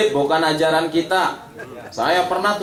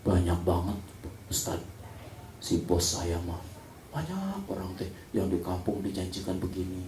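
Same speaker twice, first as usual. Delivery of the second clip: hushed aside, much softer; whispering secretary; 5 semitones lower, 12 dB quieter than usual. Retold or imitated. Secretary whispers to the ustaz about her boss's debts, hushed. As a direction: lean in and whisper the confession.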